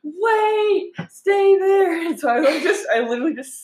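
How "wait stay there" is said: much higher, urgent mother calling through the doors; panicked mom. Retold or imitated. Mom on the tube calling back to her stranded daughter, high and urgent. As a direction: call out to the child left behind on the platform. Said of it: "And my mom's like".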